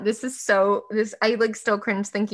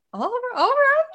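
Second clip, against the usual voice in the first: much higher, mock-flustered professor; 5 dB louder than usual. Lecturer covering the awkward silence, high mock voice.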